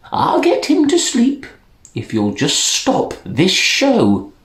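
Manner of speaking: a hint of a kindly grandmother; old woman offering to help. Old woman voiced in story.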